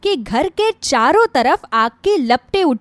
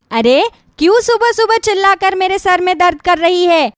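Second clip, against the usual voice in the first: louder, higher, annoyed snapping; irritated yell; 5 semitones higher, 3 dB louder than usual. Acted. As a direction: scold her for the noise. Cruel mother-in-law yells at bride. (चिढ़कर डांटती हुई)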